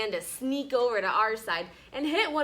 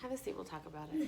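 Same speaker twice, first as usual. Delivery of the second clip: quiet, quick aside; 4 semitones lower, 12 dB quieter, much faster than usual. Real, not acted. Teacher hushing a child to sit down, quiet and quick.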